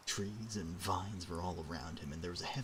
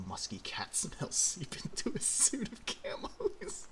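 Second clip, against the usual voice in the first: laughing; 13 semitones higher, slower than usual. Real, not acted. Narration breaks into laughter.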